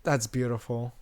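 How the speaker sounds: hushed, low wonder; softly marvel, playing it up; mock awe. Moose and Jane watching him dangle, hushed mock awe. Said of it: "be like".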